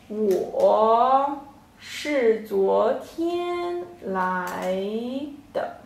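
我是昨天来的 said very slowly, word by word with pauses; slow model sentence. Shown as a demonstration. Teacher slowly models 我是昨天来的 for the class. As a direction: spell 我是昨天来的 out slowly for learners. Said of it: "So this whole sentence".

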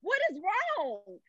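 high, slow panicked cry; alarmed mother hearing her son; Oh my God stretched high in panic. Retold or imitated.

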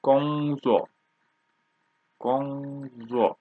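Gongzuo with a long level Gong, then falling zuo; teacher's tone demo of Gongzuo; first and fourth tone. Shown as a demonstration. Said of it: "First tone and fourth tone".

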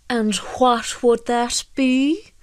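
suspicious. A narrator's reading. Fisherman questions the fairy's favour, suspicious. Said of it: "asked Michael suspiciously".

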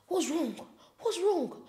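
lightly voiced worried father; a hint of an anxious dad. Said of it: "my dad was like".

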